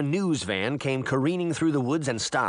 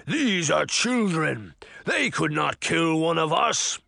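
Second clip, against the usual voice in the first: ponderous, heavy-stressed giant, pitched up; 4 semitones higher than usual. A narrator's reading. Giant dismissing Jack's accusation, booming giant voice. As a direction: proclaim it like a scornful giant.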